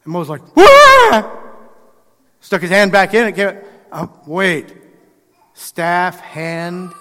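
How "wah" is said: sudden high loud yelp of fright; startled yelp. Retold or imitated. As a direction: jump back with a shocked yelp. Moses sees his leprous hand.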